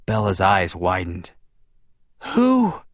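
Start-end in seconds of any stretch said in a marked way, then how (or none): 2.3-2.8 high, faint gasp; breathed question. A narrator's reading. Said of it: "she breathed".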